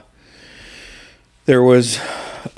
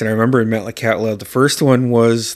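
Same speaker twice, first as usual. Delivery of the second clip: ordinary delivery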